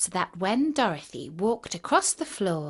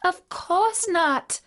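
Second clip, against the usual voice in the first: put-on high, slow girlish answer; little girl; 10 semitones higher, much slower than usual. A narrator's reading. Dorothy answering the melting witch, girlish protest. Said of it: "answered Dorothy".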